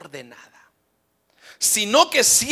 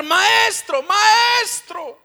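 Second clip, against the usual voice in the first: high, loud desperate calls; shouting for the master; 11 semitones higher, 6 dB louder, much slower than usual. Acted. Peter calling Jesus in the storm, desperate shout. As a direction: call out for help at the top of your voice. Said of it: "Y comienza a gritar".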